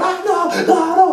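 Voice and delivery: high, mock-terrified pleading; victim pleading. Retelling a victim on TV begging, high mock terror. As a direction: whimper it like a man begging for his life. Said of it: "they're going".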